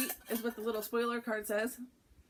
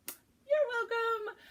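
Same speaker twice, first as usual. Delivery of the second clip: much higher, slow, sing-song gloat; smug you're welcome; 13 semitones higher, 5 dB louder, much slower than usual. Acted. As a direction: lean into a cheeky gift reveal. Gifting fabric to the viewer.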